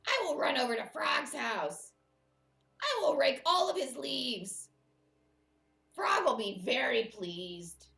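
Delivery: high, loud storybook Toad; eager Toad. Acted. Toad plans to rake Frog's leaves, eager and loud. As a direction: announce Toad's plan loudly and eagerly. Reader voices Toad planning surprise.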